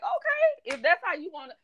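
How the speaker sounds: mock-surprised high voice, going along; taken-aback okay. Acted startled acceptance of client's manner.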